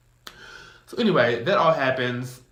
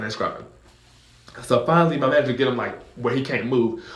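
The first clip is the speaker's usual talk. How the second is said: ordinary delivery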